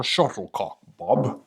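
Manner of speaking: lightly put-on English accent; a hint of Sean Connery's English-accent impression. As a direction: do a mild mock English accent. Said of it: "say that with an English accent".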